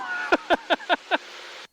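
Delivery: short airy laugh at the wind; breathy laugh. Real, not acted. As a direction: laugh it off, surprised. Laughs at being blown around.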